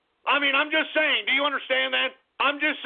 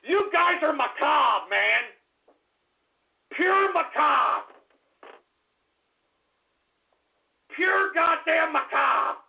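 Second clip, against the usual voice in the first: disgusted shout; 6 semitones higher, slower than usual. Real, not acted. Disgusted at train crash jokes.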